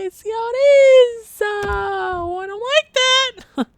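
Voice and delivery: high, drawn-out fake cry; mock whiny upset. Gamer reacting to a loss, mock whiny upset. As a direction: whine it way up high in mock hurt.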